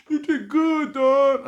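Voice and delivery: high loud mock consolation; family praise. Family consoling comic, high mock voice. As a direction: reassure him high.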